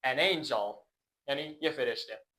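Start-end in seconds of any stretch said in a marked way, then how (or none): none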